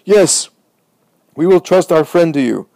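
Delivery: the people agree together at full voice; shouting. A narrator's reading. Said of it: "they end up shouting".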